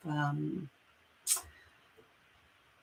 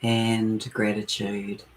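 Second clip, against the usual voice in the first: guided meditation; 2 semitones lower than usual. Acted. Leading listeners into their breath and heart space, guided meditation. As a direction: ease listeners inward, phrase by unhurried phrase.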